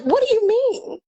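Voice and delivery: high alarmed disbelief; disbelieving squeak; retold alarm. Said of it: "I'm like".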